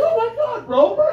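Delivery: excited recognition. Retold or imitated. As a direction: greet a long-lost pet with delight. Recognizing former dog in heaven.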